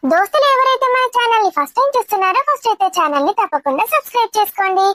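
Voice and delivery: upbeat, sing-song presenter read; channel intro. Shown as a demonstration. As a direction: greet the viewers and plug the channel with lively swoops. (Channel పరిచయం చేసే హుషారైన anchor శైలి)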